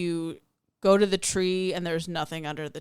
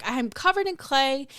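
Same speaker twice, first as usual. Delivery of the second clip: quoting her friend; 7 semitones higher than usual. Retold or imitated. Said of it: "And she was like".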